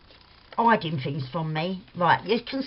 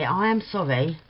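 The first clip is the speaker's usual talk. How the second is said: ordinary talk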